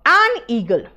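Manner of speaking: "an eagle" with the an kept distinct from eagle; clear an before vowel. Shown as a demonstration. Teacher showing the class, clear demo of an eagle. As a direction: keep the n of "an eagle" audible. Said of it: "अलग अलग लग रहा है".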